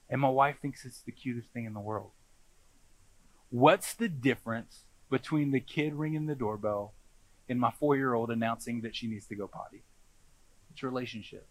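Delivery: usual speaking voice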